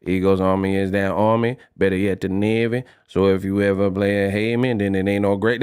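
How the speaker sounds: mock Louisiana accent, low and lazy; New Orleans flow. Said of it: "That's exactly how they be flowing out there".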